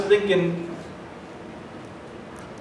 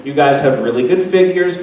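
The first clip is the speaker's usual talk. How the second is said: ordinary delivery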